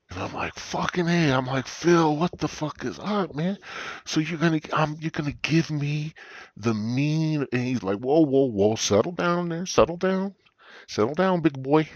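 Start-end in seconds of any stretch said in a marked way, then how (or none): 0.5-7.5 higher-pitched, eager blurting; excited retold reaction. Acts out his own excited reply. 8.0-11.9 high-pitched mock "easy now" voice; acted calming-down. Phil calms the over-eager narrator.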